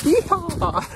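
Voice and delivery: high whooping yeehaw; yeehaw cheer. Real, not acted. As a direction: let out a cowboy whoop. Running downhill, cheering.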